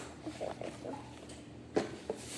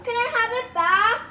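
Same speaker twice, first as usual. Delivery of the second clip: yelling across the room; 7 semitones higher, 6 dB louder than usual. Real, not acted. Calling for the charger back.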